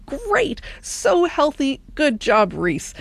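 high start, drawn-out cheery approval; imagined praise. Acted. Host voicing listeners' praise for a healthy dinner, approving. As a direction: praise him slowly, pitch sliding down. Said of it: "You might be thinking".

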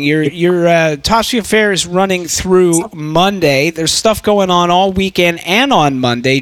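ordinary talk

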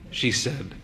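plain reading voice

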